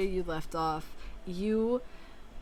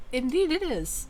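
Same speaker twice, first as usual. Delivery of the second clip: lightly voiced Clement; 6 semitones higher than usual. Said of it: "Clement clears his throat".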